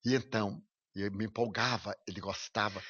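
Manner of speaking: ordinary talk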